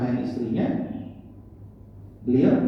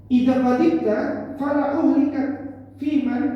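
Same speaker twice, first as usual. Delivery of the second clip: recited hadith; 11 semitones higher, 3 dB louder than usual. Acted. Preacher recites Arabic hadith loudly.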